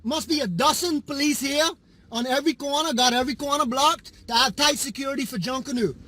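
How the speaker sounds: ordinary delivery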